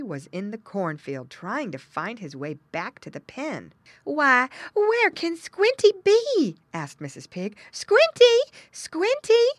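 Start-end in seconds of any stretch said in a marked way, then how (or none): 4.0-6.6 very high, loud, drawn-out question; puzzled mother pig. A narrator's reading. Mother pig looks for missing piglet, calling out. 7.7-9.5 loud, very high name call; calling, squealing. A narrator's reading. Mother pig calls her missing piglet, squealing call. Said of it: "she called, grunting and squealing".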